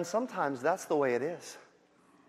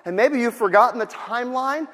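exasperated Martha; 6 semitones higher, 8 dB louder than usual. Retold or imitated. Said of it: "she goes".

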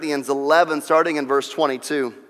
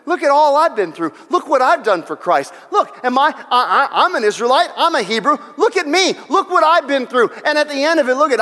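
high, loud show-off bragging; hammed-up self-praise, pitched well up and louder; mock braggart; 11 semitones higher, 6 dB louder than usual. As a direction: beg for applause like a bragging child. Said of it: "Paul says".

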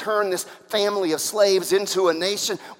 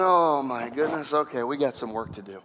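low, subdued voice of a weary God; soft, deep, patient exasperation; God, exasperated; 7 semitones lower, 4 dB quieter than usual. Acted. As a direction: drop low, as if resigned to a long job. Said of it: "and God's going".

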